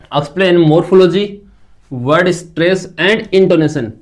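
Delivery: slow, emphatic dictation. Shown as a demonstration. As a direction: drag each word out for the class to write. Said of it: "लिख लो भाई".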